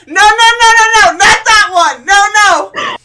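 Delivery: very high, very loud screaming no; panicked shouting at the beast; frantic yell. Acted. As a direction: scream to stop her biting. Caspian yelling at Yuli.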